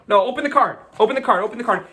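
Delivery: high, loud repeated command; urgent urging. Real, not acted. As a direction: urge the volunteer on excitedly. Urging volunteer to open card.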